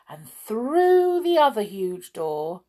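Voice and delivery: ordinary delivery, nothing marked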